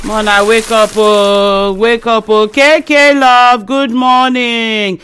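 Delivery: drawn-out wake-up call. Real, not acted. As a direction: call the names out long to rouse listeners. Caller calls out morning wake-up greetings.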